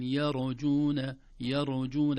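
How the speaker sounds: يرجون with a bouncing ر, the wrong way; wrong bounce. Shown as a demonstration. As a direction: put an echo on the ر of يرجون. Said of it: "الراء الساكنة ليس فيها قلقلة".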